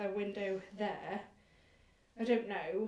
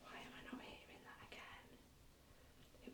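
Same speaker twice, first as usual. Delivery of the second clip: fast hushed whisper; 22 dB quieter, much faster than usual. Real, not acted. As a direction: whisper quickly, puzzled. Whispering during ghost hunt.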